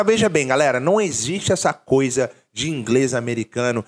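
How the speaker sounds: ordinary delivery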